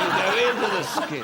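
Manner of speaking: amused laughing repeat. Real, not acted. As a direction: chuckle the phrase out again.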